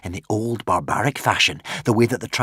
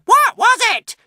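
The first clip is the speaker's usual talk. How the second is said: very high, loud shriek; 11 dB louder than usual. A narrator's reading. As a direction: shriek at him. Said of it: "shrieked the witch".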